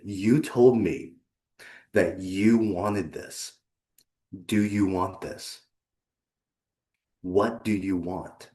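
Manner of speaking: slow, deliberate challenge, leaving long gaps; slow confrontation. Acted. Coach looks client in the eye, slow and deliberate challenge. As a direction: put each word to them slowly and squarely.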